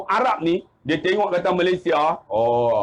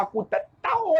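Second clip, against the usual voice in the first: high-pitched exasperated mock reply; comic helpless bewilderment; baffled protest; 7 semitones higher, 3 dB quieter than usual. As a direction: imitate a flustered villager, voice shooting up. Baffled villager acted.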